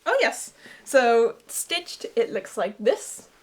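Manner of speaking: normal talking voice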